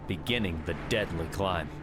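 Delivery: plain reading voice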